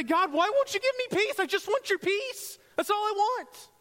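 very high, loud shouted begging; exasperated plea. Acted. Believer throwing up hands at God, exasperated shouted plea. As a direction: wail upward in hammed-up desperation. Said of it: "we throw up our hands and say".

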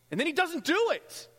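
high, loud cry of frustration; exasperated shout. Acted. Preacher voicing a believer whose prayer goes unanswered, exasperated shout. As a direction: shout it as an acted complaint.

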